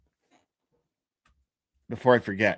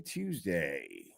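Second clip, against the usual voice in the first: much lower, softer put-on voice for Tuesday; deep echo; 11 semitones lower, 13 dB quieter than usual. Host echoing his own greeting in a low, slow voice. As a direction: drop way down for a mock announcer echo.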